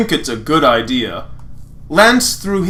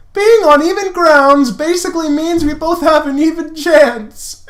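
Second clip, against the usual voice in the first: high, a touch of laughter in it; high and loud, cracking up a bit; Lance voice; 13 semitones higher, 4 dB louder than usual. Acted. Lance explaining fair play, high and near laughing.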